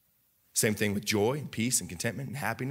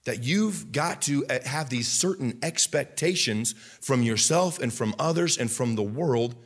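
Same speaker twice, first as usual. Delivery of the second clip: normal talking voice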